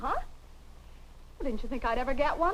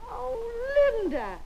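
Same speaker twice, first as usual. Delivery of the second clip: delighted; 11 semitones higher, much slower than usual. Acted. Mother delighted by the gift coat.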